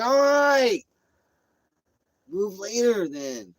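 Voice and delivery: very high, loud, slow cartoon cop; mock cop. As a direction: stretch out the cop's order in a squeaky mock voice. Cop voiced in retold encounter.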